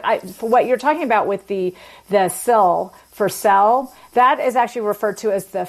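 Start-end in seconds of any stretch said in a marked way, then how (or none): 2.1-3.9 sill and sell spelled out slowly, sell rising; slow demonstration. Shown as a demonstration. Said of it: "the feel fill merger".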